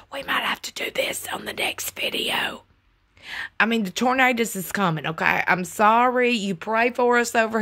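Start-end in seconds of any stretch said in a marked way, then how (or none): 0.1-2.6 soft whispered aside to viewers; hushed, teasing whisper; playful ASMR whisper. Pretend. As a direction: slip into a mock ASMR whisper. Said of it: "You gotta whisper like this".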